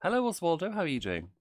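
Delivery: high, bright hello to a newcomer; excited greeting. Real, not acted. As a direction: call out a cheerful hello.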